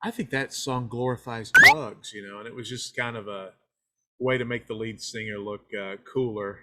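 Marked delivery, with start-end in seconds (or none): 0.0-2.1 loud, slow mock scolding; a hammed-up scold; mock preachy complaint. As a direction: lecture them like a killjoy. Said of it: "I'd be like".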